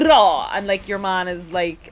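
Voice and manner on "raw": loud rolled-r roar; imitated Vine noise. Imitating a Vine for a friend, loud trilled roar. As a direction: burst out a trilled "rah". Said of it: "do you know that Vine where they".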